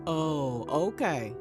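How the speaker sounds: normal talking voice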